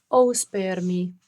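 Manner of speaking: slow, loud plea; pleading. Acted. Margareta begs the man she takes for the hangman, slow and loud. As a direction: drag out a desperate plea for mercy.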